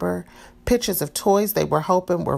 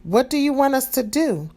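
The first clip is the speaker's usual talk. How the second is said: put-on small boy voice, higher; piping up; 8 semitones higher than usual. A narrator's reading. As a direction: pipe up eagerly like a little boy. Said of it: "Danny piped up first".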